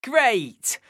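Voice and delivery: normal talking voice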